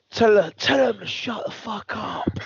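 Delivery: a hammed-up impatient missus; imitating the missus. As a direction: snap it like a fed-up partner in labour. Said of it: "my missus was like".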